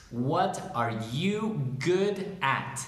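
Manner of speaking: each word spaced out slowly; slow dictation. Shown as a demonstration. Teacher dictating question two to students, slow and separated. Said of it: "question number two is".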